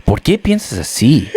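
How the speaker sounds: lightly voiced Spanish-speaking dad; a hint of a laughing dad in Spanish. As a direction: mimic dad asking in Spanish. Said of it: "it's worse in Spanish".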